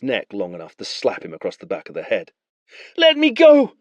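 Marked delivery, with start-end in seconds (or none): 3.0-3.8 high, loud desperate cry; boy crying out. Acted. Boy cries out to his captor, desperate. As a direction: beg to be let go. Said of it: "the boy cried".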